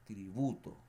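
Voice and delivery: "Tributo" with a punched "BU"; stress on middle syllable. Shown as a demonstration. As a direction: say "Tributo" as tri-BU-to. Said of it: "yo cargo la voz en".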